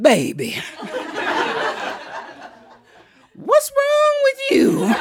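a high shout through laughter; startled great-grandma. Retold or imitated. Great-grandma shouts at the girl, laughing. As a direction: cry out in amused alarm. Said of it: "she said".

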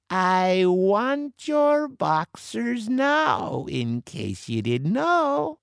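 high, slow, drawn-out demand; Stinky Annie, slowly gloating; menacing pirate woman. A narrator's reading. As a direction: taunt your cornered foe. Annie menaces cornered Joe.

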